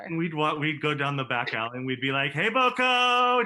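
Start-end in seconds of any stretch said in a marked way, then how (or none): 2.3-3.4 very high, loud, slow yell; screaming. Retold or imitated. Students screaming in the back alley. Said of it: "just screaming".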